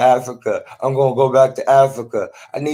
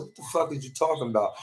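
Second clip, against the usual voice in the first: much softer, quick reply; retold baffled retort; 10 dB quieter, much faster than usual. Speaker recounting their reply to hospital evaluator, quick hushed retort.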